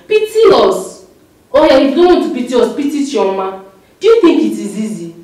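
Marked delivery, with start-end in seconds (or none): 0.1-1.1 high, slow begging wail; desperate plea. Acted. Pleading with brother on phone.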